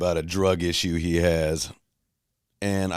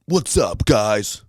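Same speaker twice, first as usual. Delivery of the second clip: mock hyped YouTuber "What's up, guys?", much higher and louder; YouTuber greeting; 5 semitones higher, 5 dB louder, much slower than usual. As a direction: ham up the big YouTube intro. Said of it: "Every episode".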